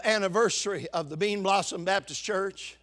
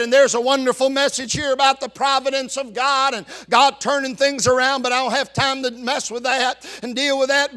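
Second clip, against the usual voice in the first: ordinary talk, nothing marked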